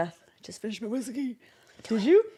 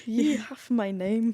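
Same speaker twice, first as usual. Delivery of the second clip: put-on Scottish accent; 3 dB quieter, slower than usual. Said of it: "put ye in there".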